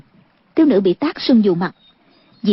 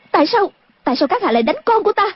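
crying out; 8 semitones higher, faster than usual. A narrator's reading. Mother cries out at the man who struck her daughter. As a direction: protest urgently in a raised pitch. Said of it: "Thiếu phụ la lên".

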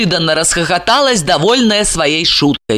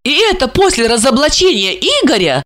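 high, incredulous question; surprised female character voice; astonished woman; 6 semitones higher than usual. A narrator's reading. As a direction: ask in amazement, pitched up. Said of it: "поразилась Регина".

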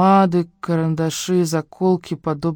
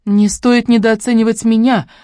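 slow, high, loud retort; firm warning; 7 semitones higher, 5 dB louder than usual. A narrator's reading. Woman answering the investigator's threat, firm warning. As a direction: warn him back, measured and raised. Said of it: "предупредила я".

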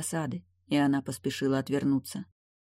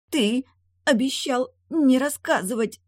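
strained, slow, higher gurgling plea; weak gurgle; 9 semitones higher, 4 dB louder than usual. A narrator's reading. Veselina, held by the chain, weakly gurgling. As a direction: squeeze it out weakly, half choked. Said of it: "слабо булькнула Веселина".

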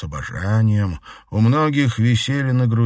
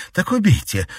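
defiant; 11 semitones higher, 4 dB louder than usual. A narrator's reading. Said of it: "с вызовом бросила Катерина".

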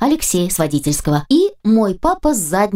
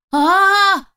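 yelling together; 11 semitones higher than usual. Acted. Men in front seats yell at the truck, high frightened yell. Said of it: "орут на передних сиденьях".

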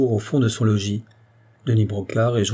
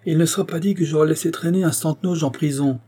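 raised, emphatic protest; called out; 6 semitones higher than usual. A narrator's reading. Doctor stopping the gendarmes, lightly acted cry. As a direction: cry halt with a moderately raised voice. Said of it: "cria-t-il".

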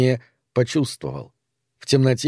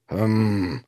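creature's grumble; 2 semitones lower than usual. A narrator's reading. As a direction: grumble wordlessly, mulling it over. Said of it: "заворчало существо".

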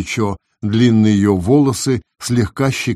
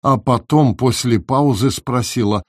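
ordinary delivery, nothing marked

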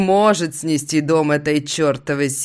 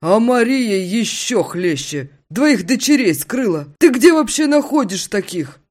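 higher, louder buddy voice; friend voice; 7 semitones higher, 5 dB louder than usual. Acted. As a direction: tease him with a raised voice. Friend Vitya keeps talking.

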